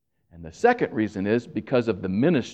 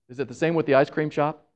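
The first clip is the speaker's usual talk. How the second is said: usual speaking voice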